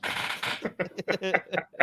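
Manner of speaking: airy cackle of disbelief; high breathless laugh. Real, not acted. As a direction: wheeze with laughter. Cracking up at own story.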